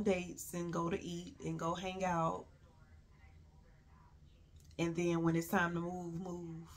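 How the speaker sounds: hushed. Real, not acted. Bitter hushed retelling.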